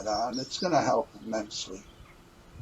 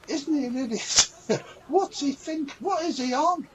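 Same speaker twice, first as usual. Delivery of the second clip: mock scoffing; 12 semitones higher, 3 dB louder than usual. Mocking someone's meditation teacher.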